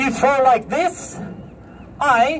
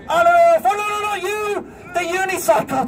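sudden panicked no's, pitched way up; alarmed high cries; 6 semitones higher than usual. Real, not acted. Panicked high-pitched no's. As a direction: street performer wobbling on the unicycle, panicking at the helpers. Unicycle tilting wrong way, alarm.

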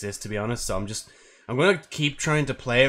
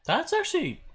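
surprised. Real, not acted. First sip of drink.

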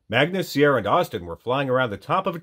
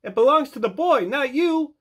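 high, urgent reproach; scolding dragonfly; 3 dB louder than usual. A narrator's reading. Magnus to Snake stealing the shoe, high scolding voice. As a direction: tell him off, high and firm.

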